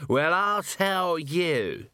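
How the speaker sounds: put-on slow, slurred drunken ranting; drunk Nobby. A narrator's reading. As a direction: drag every word out like a swaying drunk. The swaying now so regular.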